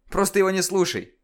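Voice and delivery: выше и громче, убеждая; твёрдо отрежь; настойчиво. Acted. Герой успокаивает девочку. (настойчивый громкий совет)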